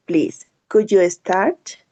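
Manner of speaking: ordinary talk, nothing marked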